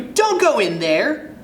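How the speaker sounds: high sharp call to stop. Acted. Jane calling after the fleeing kid, loud. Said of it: "Jane calls to you".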